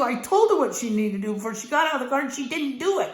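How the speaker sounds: ordinary talk, nothing marked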